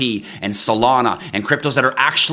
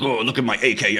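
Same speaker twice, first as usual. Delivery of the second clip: mock tough guy. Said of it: "it makes you tough feeling like".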